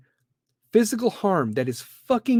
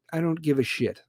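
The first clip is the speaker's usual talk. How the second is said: usual speaking voice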